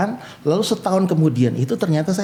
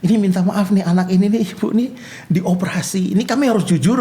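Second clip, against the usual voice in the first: imitated doctor, frank and higher-pitched; blunt doctor; 5 semitones higher than usual. Doctor warning a mother about surgery, blunt. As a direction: retell it as a straight-talking doctor. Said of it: "Ngomongnya terus terang lagi dokter".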